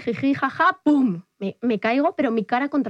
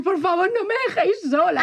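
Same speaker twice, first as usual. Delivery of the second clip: mock pleading; 8 semitones higher than usual.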